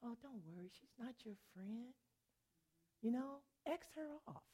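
soft, honeyed mock persuasion; smooth and gentle. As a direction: sweet-talk them like a smooth deceiver. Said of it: "smooth and gentle and say".

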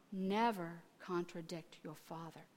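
low, hushed fatherly admonition; quiet, loving correction. Retold or imitated. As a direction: repeat it softly, with love and weight. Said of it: "didn't say it meanly".

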